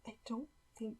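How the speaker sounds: broken, slow confession with long gaps; tearful admission. A narrator's reading. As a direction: force it out between sobs. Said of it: "started to violently cry".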